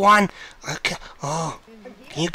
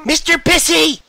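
loud, taunting call; teasing shout; 3 semitones higher, 7 dB louder than usual. Pretend. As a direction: call the name out loudly. Calling out mockingly in game.

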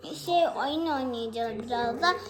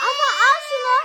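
high, loud call to her brother; shouting; 10 semitones higher, 7 dB louder, faster than usual. Real, not acted. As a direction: shout it across the room. Calling brother, handing something.

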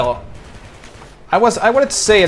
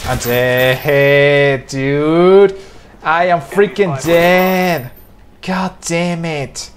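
raging gamer; much slower than usual. Real, not acted. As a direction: drag it out in rage. Killed again mid-match.

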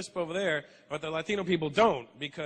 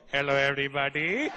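put-on Indian accent; 4 semitones lower, 5 dB louder than usual. Indian king greeting his people, Indian accent. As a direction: lower Indian king greeting with a head tilt. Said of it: "we sounded like this".

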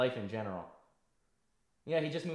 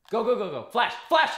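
loud urgent combat callouts; shouting callouts; 9 semitones higher, 12 dB louder than usual. Real, not acted. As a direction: yell orders to the squad mid-fight. Live firefight callouts.

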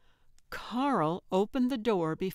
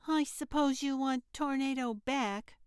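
put-on pouting child; 7 semitones higher than usual. A narrator's reading. Carl to Gertrude about giving back the cat, pouting. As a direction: sulk like a kid losing a pet.

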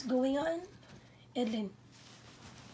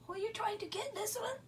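much higher sing-song pet voice; 11 semitones higher, 8 dB louder, faster than usual. Real, not acted. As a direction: coo at the dog. Baby-talk to pet.

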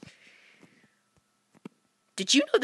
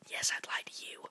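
sneaky whispered confession; hushed mock confession; 15 dB quieter than usual. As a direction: admit it in a whisper.

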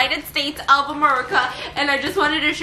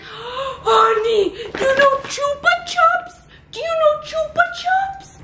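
high, slow, gushing excitement; excited high squeal; 12 semitones higher, slower than usual. Real, not acted. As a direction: gush about it, voice way up. Excited high drawn-out question.

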